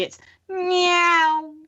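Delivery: cat meow sign-off. Pretend.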